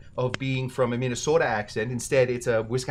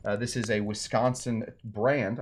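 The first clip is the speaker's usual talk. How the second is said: ordinary delivery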